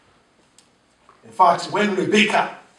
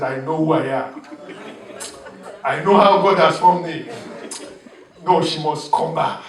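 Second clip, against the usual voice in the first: confident man; 3 dB louder than usual. Retold or imitated. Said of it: "looked at himself and said".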